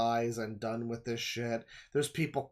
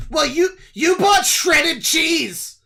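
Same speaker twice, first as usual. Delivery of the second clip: much higher, much louder caricature of a screaming commenter; hammed-up furious lecture over cheese; mock nitpicking scold; 15 dB louder than usual. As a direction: yell at them like a petty online scold. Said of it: "nitpick what you bought and be like".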